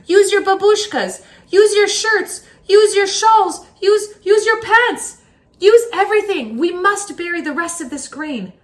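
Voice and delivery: high, loud repeated urging; rally the villagers to hurry; urgent shout. Acted. Urging villagers to bury grain.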